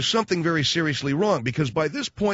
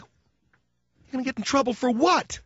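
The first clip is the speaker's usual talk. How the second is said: incredulous; 10 semitones higher, slower than usual. Retold or imitated. Host incredulous at kids.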